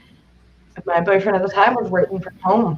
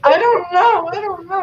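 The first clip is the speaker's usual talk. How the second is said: panicked; 12 semitones higher than usual. Retold or imitated. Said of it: "I'm like".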